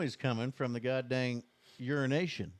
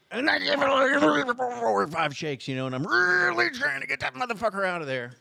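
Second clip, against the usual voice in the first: strained; 10 semitones higher, 4 dB louder than usual. Retold or imitated. Straining at the stuck zipper.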